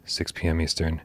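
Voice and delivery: high, slow show-open announcer read; announcer intro. Acted. Host opening the live show, announcer style.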